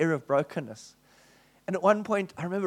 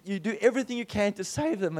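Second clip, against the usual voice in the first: ordinary talk, nothing marked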